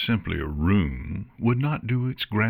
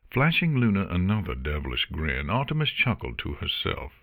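ordinary delivery

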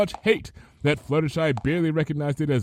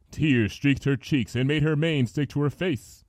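plain reading voice

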